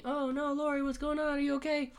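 high-pitched worried cry; mock panic. Retelling Dan hearing Laurie scream, high mock panic. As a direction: call out in hammed-up alarm.

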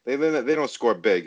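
usual speaking voice

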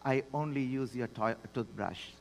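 low, hushed, composed reply; calm deadpan punchline, dropping away. Retold or imitated. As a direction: deliver the wife's answer softly and evenly. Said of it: "So very calmly she said".

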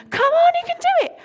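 high, loud, fast cheering; shouting encouragement. Retold or imitated. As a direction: scream it from the side of the track.

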